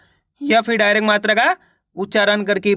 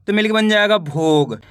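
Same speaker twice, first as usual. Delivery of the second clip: normal talking voice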